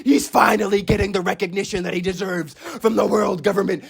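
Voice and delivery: ordinary talk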